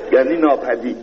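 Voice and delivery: ordinary delivery, nothing marked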